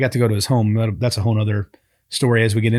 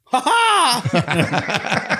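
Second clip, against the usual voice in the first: big, high-pitched "ha ha!"; imitated belly laugh; 11 semitones higher than usual. One host mimicking the man he met, imitated booming laugh. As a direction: belt out a high, showy laugh.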